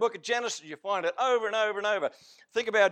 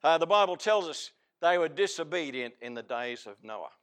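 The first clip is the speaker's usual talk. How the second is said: usual speaking voice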